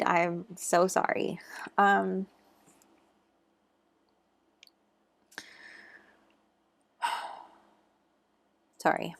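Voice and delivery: real tears close, a sigh and a whispered sorry; fighting back tears, barely voiced; choked up. Real, not acted. Tarot reader apologising to Virgo viewers, choking up. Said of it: "I'm getting a little emotional".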